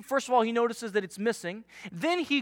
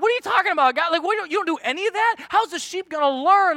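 incredulous; 6 semitones higher, faster than usual. Retold or imitated. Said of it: "we read that and we're like".

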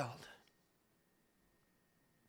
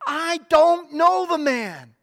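loud, slow, emphatic denial; denial with an oath; 6 semitones higher, 8 dB louder, slower than usual. Acted. Peter denying Jesus to the people at the gateway, loud and firm. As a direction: swear it off, pushing the accusers away. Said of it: "He denied it again with an oath".

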